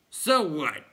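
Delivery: dismissive clown. A narrator's reading. Clown answering the others, loud retort. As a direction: toss it back cheekily.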